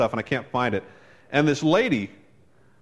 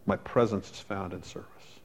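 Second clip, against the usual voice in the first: soft quote; 6 semitones lower, 9 dB quieter than usual. Retold or imitated. Quoting Jesus softly.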